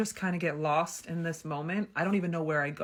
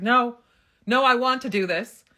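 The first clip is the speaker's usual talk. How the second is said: insistent no; 7 semitones higher, 11 dB louder than usual. Retold or imitated. Insisting she wants to continue.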